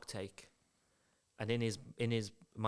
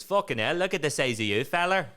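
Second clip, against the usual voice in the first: loud, raised imitation of an intimidating guide; 5 dB louder than usual. Tour guide to visitor in the queue, intimidating. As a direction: imitate a loud man sizing someone up. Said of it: "said it in such an intimidating way".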